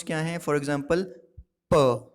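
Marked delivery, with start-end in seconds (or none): 1.7-2.1 crisp plosive प with a puff of air; model sound pa. Shown as a demonstration. Teacher modelling प for class to repeat. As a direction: say प clearly for students to copy. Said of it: "You can repeat after me".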